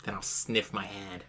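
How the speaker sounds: usual speaking voice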